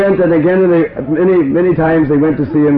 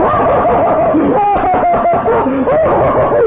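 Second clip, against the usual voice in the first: joyful booming laugh. Acted. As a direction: laugh out with a father's pure joy. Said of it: "he just began to laugh".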